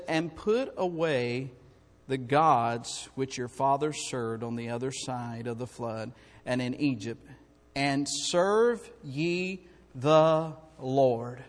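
very slow, drawn-out reading; slow solemn scripture. Real, not acted. Pastor to congregation, reading the verse very slowly. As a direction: stretch each word of the verse.

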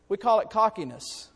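usual speaking voice

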